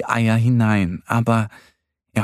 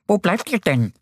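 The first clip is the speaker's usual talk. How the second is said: high, hurried hare voice; hasty call; 8 semitones higher than usual. A narrator's reading. Easter Bunny looking for the late friends, hasty. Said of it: "hören sie hastige Rufe".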